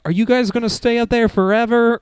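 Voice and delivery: high, put-on movie character voice; mock calling-up voice, pitched high; imitated call upstairs. Quoting a movie character left below, high mock call. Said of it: "he's like".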